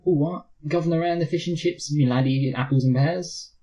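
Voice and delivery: a high, hammed-up fake English accent. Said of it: "a noticeably fake English accent".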